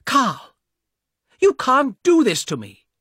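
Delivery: high, loud furious cry at her brother; angry shout. A narrator's reading. Young woman shouting at her brother on the train, loud and angry. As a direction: yell it at him, outraged. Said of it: "shouted at the man".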